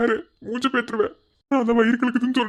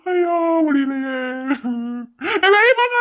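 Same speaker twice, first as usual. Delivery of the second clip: agonized wailing; 3 semitones higher than usual. Acted. Desperate for the toilet.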